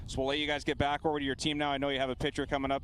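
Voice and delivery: fast, hurried wrap-up; breathless sideline reporter. Real, not acted. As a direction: rush the sign-off quickly. Sideline reporter wrapping up interview.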